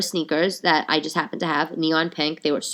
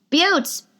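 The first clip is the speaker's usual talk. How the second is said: high-pitched cheery holler; high call-out; 11 semitones higher than usual. Real, not acted. Host hailing her fans, high call-out. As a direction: sing out to the listeners. Calling out to listeners at sign-off.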